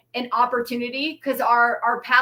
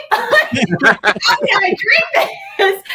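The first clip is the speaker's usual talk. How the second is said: slow, high, loud laughing protest; high and loud through laughter, appalled at the scotch; laughing shout; 9 semitones higher, 8 dB louder, much slower than usual. Real, not acted. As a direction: laugh it out loudly in disbelief. Laughing at own disgust.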